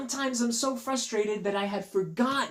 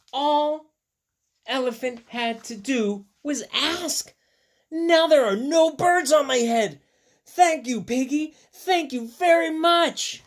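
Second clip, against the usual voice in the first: higher, louder happy thanks; grateful excitement; 8 semitones higher, 6 dB louder than usual. Acted. Elephant thanking Piggy happily. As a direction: gush your thanks.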